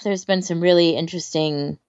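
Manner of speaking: normal talking voice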